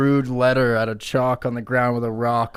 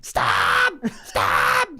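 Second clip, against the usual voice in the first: mock shout. Q begging Grimace to stop, a mock pained yell. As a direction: yell stop as if being crushed.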